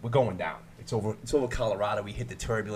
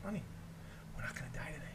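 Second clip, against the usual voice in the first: reassuring; 19 dB quieter, faster than usual. Retold or imitated. Calming panicked woman on plane.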